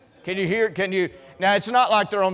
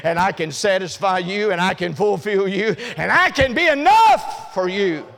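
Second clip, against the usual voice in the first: preaching that builds to a loud, higher shout; 4 semitones higher, 6 dB louder than usual. Acted. Preacher to his congregation, building to a shout. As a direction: rise into full-force preaching on the last line.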